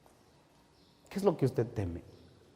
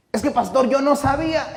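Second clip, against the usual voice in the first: mock whiny excuse; 13 semitones higher, 9 dB louder than usual. Mocking a sinner's excuse.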